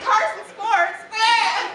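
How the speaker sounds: high announcer shout; mock sports announcer. Pretending to know sports, high announcer voice. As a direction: bark it like a sports host.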